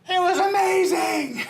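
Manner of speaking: shouting it high and slow, thrilled; overjoyed yell, dragging it out; excited husband. Retold or imitated. Husband excited after the meeting.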